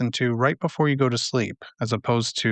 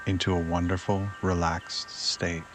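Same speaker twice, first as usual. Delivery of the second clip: guided meditation; 3 semitones lower, 7 dB quieter than usual. Acted. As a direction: ease them down, softer and lower than everyday talk.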